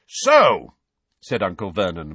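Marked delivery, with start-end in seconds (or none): 0.1-0.7 loud, high boom. A narrator's reading. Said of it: "voice restored to full and considerable volume".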